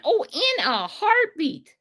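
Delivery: HEARTBEAT stretched out slowly, starting high; emphatic retort. Retold or imitated. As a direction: draw out "in a heartbeat" with total conviction.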